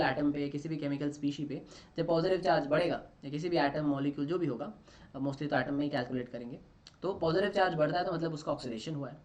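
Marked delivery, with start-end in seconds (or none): none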